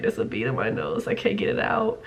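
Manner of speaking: low, near-whispered admission; hushed confiding. Retold or imitated. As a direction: confide it quietly, under your breath. Embarrassed confiding to a friend.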